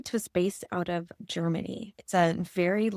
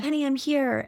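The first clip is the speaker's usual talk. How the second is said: put-on high, bright soothing to a crying child; reassuring mom; 8 semitones higher, 3 dB louder than usual. Mother reaching her crying toddler at daycare, soothing high. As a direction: reassure your little boy you're here.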